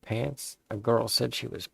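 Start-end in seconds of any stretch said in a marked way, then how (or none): none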